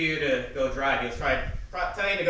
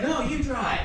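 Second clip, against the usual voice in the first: high, raised-voice refusal; retold protest; 12 semitones higher, 4 dB louder, slower than usual. Shaken learner refusing to drive, retold protest. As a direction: throw it back at dad, acted out.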